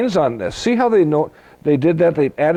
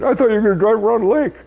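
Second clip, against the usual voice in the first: much higher, fast complaining wife; wife's complaint; 9 semitones higher, much faster than usual. Retold or imitated. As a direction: whine it like the let-down wife. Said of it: "She says".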